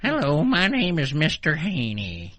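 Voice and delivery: lightly voiced hillbilly Mister Haney; Mister Haney impression. Friend shows off her Mister Haney voice, lightly voiced. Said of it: "I can do Mister Haney".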